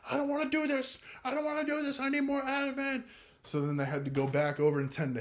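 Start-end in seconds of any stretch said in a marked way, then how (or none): 0.1-3.0 much higher, desperate begging in a mock voice; imitating panicked pleading. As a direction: mimic a grown man begging for relief. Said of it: "screaming that he needs more Ativan".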